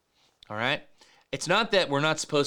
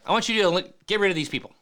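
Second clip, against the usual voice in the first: raised, higher voice pleading for justice; David's angry demand; 5 semitones higher, 5 dB louder, much faster than usual. Retold or imitated. David demanding that God get rid of his enemies, raised and heated. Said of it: "He's like".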